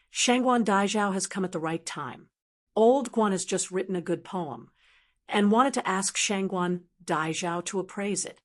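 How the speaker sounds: usual speaking voice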